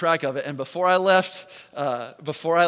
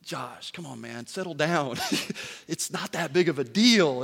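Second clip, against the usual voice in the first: calming inner voice; 4 semitones lower, 6 dB quieter than usual. Retold or imitated. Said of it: "verses start running through my mind".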